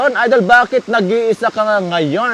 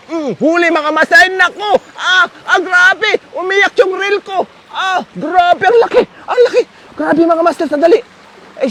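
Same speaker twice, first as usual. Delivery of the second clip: high, thrilled shouting over the catch; real fishing excitement, pitched high; excited; 8 semitones higher than usual. Real, not acted. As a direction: voice jumps up with the fish on the line. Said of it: "medyo na-excite ako dun".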